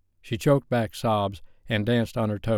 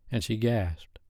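ordinary delivery

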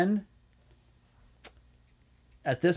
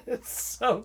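high, slow words through real laughter; cracking up while saying it; laughing; 8 semitones higher, slower than usual. Real, not acted. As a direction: giggle it out, pitched way up. Laughing at the eyebrow bit.

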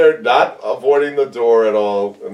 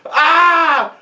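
mock yelp; 12 semitones higher than usual. Retelling the kick scene.

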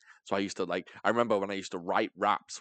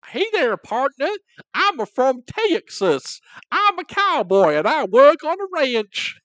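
put-on loud, high, drawn-out Texan cowboy accent; hammed-up Texas rancher announcing himself; Southern American; 11 dB louder, slower than usual. Asked for his American, hammed-up Texan drawl. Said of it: "give us your American".

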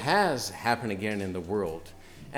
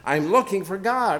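a madman's raised cry, pitched up; cried out; 8 semitones higher, 8 dB louder than usual. A narrator's reading. Preacher reading Nietzsche's parable, the madman crying out. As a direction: call out searching for God. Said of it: "cried incessantly".

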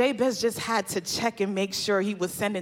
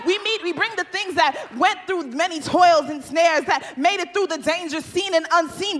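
repeated lines rising steadily; building sermon climax; 6 semitones higher, 5 dB louder than usual. Acted. Preacher telling the congregation what to bring to God, rising. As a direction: let the refrain swell.

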